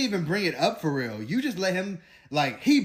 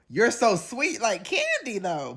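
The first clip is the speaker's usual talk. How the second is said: higher, slow cooing; sweet coo; 6 semitones higher, slower than usual. Real, not acted. Saying goodbye to Amaya, slow and cooing.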